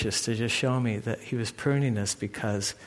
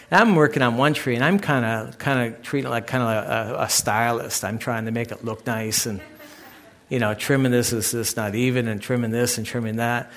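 ordinary talk

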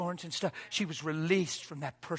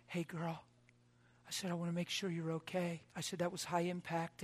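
gentle concern; 11 dB quieter than usual. Retold or imitated. Gently checking on crash victim.

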